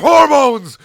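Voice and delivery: screamed at full force; shout. A narrator's reading. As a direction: scream the last word. Rant peak.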